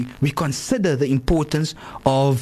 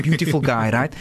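ordinary delivery

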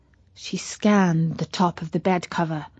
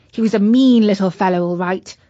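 ordinary delivery

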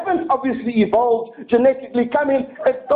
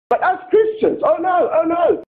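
mock outrage; 7 semitones higher than usual.

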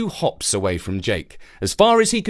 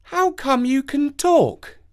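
put-on slow high boy voice; curious little boy; 13 semitones higher, slower than usual. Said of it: "he added".

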